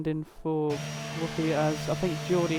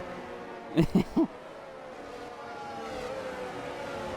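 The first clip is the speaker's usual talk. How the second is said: soft laugh; 8 semitones higher, 5 dB quieter than usual. Real, not acted. Laughs at confusing chat lingo.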